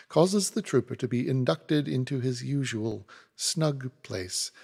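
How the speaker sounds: plain reading voice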